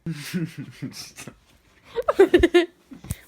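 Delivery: bursting out laughing; big loud real laugh. Real, not acted. Laughing loudly at someone.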